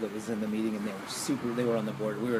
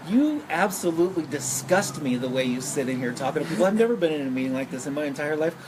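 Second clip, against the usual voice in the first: retold fury; 4 semitones higher, 3 dB louder than usual. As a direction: tell them off with disgust. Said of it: "she unleashed all fury of hell on them".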